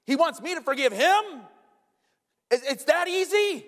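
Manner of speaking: very high, loud incredulous outburst; mock outrage at the nerve of it; indignant disbelief. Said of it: "And I'm like".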